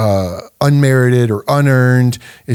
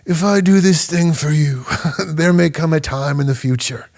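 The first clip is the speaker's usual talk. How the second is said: Godfather impression; 2 semitones higher than usual. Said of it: "he looks at him and says".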